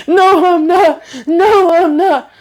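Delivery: high, whiny, slowed mock sob voice; mock crying. Mimicking Bronwyn as she breaks down, in a high whiny mock cry. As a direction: imitate her blubbering through the words.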